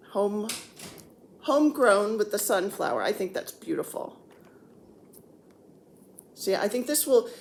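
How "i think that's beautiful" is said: ordinary delivery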